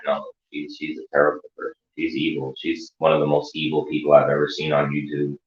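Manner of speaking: quiet dark warning; grave hush. Real, not acted. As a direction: drop your voice to warn them. Grave warning about Stacey.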